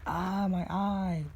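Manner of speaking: high, slow yelp of pain; pained cry. Acted. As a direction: stretch out the hurt cry after a poke in the eye. Pencil shoved in his eye.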